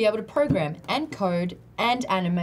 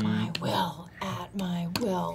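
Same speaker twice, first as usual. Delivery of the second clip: whisper; 2 semitones lower, 7 dB quieter, slower than usual. Pretend. Whispers the question phrase.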